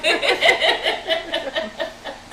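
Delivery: high giggling laugh; real amused laugh. Real, not acted. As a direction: burst out laughing. Laughing at the chat.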